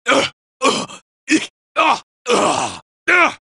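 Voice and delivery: short hurt grunts, one after another; grunting under fire; pained grunts. Acted. Wounded robot grunts while being shot, pained. Taking damage in battle.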